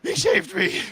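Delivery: loud, rising overacted wail; hammed-up lament. Streamer acting the dad's absurd grief, hammed-up. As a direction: cry it out like bad melodrama. Hammed retelling of show line.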